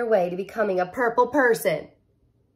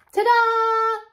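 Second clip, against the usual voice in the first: much higher, louder ta-da; excited reveal; 13 semitones higher, 4 dB louder than usual. Acted. Penny unveils her guide, excited ta-da. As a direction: burst out with the reveal.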